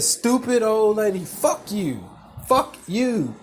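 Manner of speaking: punchy, hammed-up insult; mock angry insult. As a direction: re-enact telling her off. Retold insult repeated.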